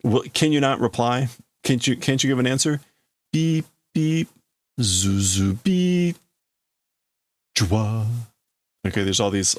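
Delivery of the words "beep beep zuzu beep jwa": goofy speaker sounds. Acted. Reader imitating noises over the speaker, goofy. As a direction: mimic a glitching loudspeaker. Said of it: "goofy sounds coming over the".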